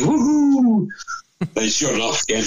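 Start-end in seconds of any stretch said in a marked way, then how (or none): 0.0-0.9 joyful whoop. Retold or imitated. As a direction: whoop way up high and loud. Celebrating the easy vineyard job.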